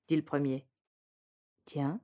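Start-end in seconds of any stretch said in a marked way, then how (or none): 1.6-2.0 a soft, low under-the-breath tiens; said quietly. A narrator's reading. Epaminondas murmuring quietly at the bar. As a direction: murmur it low, barely aloud. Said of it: "dit tout bas Epaminondas".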